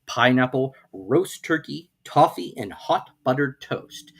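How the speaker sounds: plain reading voice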